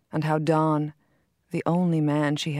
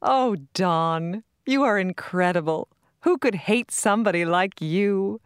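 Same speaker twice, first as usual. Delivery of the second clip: laughing; 3 semitones higher, 3 dB louder than usual. A narrator's reading. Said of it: "Her laughter pealed".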